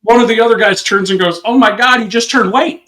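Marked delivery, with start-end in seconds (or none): 1.4-2.8 higher, raised-voice mock astonishment; retold joking outcry. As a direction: call out the punchline in mock shock.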